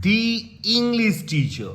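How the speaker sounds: "The" said as "dee", very slow; "dee" before English. Shown as a demonstration. Teacher slowly models the English teacher with "dee". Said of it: "English ই সাউন্ডটা আসছে".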